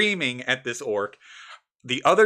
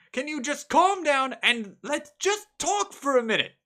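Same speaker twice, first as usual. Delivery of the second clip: high, slow, loud falsetto townsman; high outraged villager voice; flustered human character; 6 dB louder, slower than usual. Acted. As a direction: play a shrill, scandalized NPC. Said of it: "they hear the voice of the sole human".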